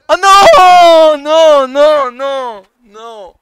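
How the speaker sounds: loud, very high drawn-out wail of dismay; long screamed "no"; anguished shout. Real, not acted. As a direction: cry out in disbelief, fading on the last no. Sees the play fail.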